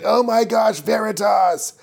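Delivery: squeaky mock gasp, pitched well up; high shocked villain. Podcaster voicing the witch Umar seeing Veritas, high mock alarm. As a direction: play a startled witch, high and shrill-free.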